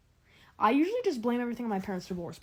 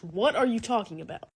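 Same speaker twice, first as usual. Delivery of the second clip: usual speaking voice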